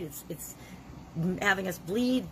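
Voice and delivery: ordinary delivery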